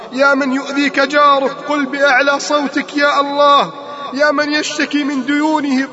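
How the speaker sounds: rousing repeated call to the congregation, pitched above the calmer talk; impassioned preaching. Real, not acted. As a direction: urge the whole crowd to cry out with you, insistent and ringing. Rousing litany calling on listeners. (وعظ حماسي بنداء متكرر)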